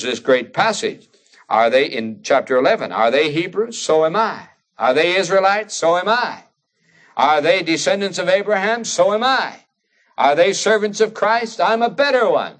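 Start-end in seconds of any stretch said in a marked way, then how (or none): 2.9-12.6 foolish boasting. Retold or imitated. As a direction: pitch climbing with each boast, imitating an escalating brag. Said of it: "talks like this is boasting foolishly".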